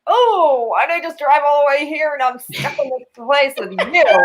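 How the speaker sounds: mildly hammed-up dismay, opening high on "Oh"; mock dismayed. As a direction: play at regretting the whole trip. Imagined regret on a bad date.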